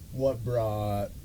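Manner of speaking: normal talking voice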